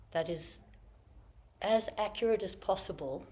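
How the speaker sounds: normal talking voice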